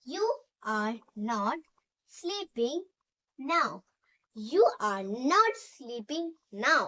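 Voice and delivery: very slow, word by word, for students to write; slow dictation. Shown as a demonstration. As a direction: spell it out slowly so the class can copy. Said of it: "dictation to students".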